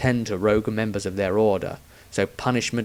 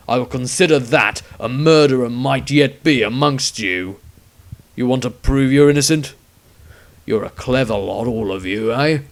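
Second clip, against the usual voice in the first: loud, commanding menace; stern witch hunter; 6 dB louder than usual. A narrator's reading. Witch hunter ultimatum to the suspects, loud stern command. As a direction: lay down the terms.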